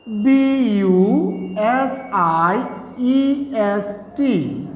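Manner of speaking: very slow, drawn-out letters; spelling out. Shown as a demonstration. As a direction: dictate each letter with space around it. Spells the word for students. (খুব ধীরে একটা একটা অক্ষর বলে)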